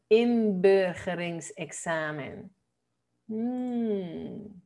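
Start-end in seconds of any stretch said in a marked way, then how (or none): 0.1-2.5 inburgeringsexamen, slow, the U said as a short Dutch eu-like E; sounded with E. Shown as a demonstration. Teacher correcting the vowel in "inburgeringsexamen", slow model pronunciation. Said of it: "Con E".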